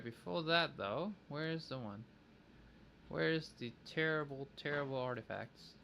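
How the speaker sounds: ordinary delivery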